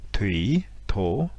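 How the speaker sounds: "tui" and "tho" each dipping, then rising; interrogative tone. Shown as a demonstration. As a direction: separate words with a questioning upturn. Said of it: "deux termes qui utilisent l'accent interrogatif".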